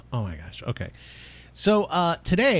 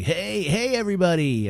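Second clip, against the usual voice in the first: higher-pitched, hammed-up host hello; imitated show greeting; 6 semitones higher, 3 dB louder than usual. Host replaying his intro while editing, mock-cheery greeting. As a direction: sing out a mock welcome. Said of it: "and I'm like".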